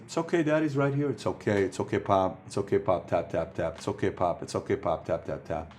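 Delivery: soft, hushed reassurance; calm a frightened child in the water; soothing dad. Retold or imitated. Father soothing scared son.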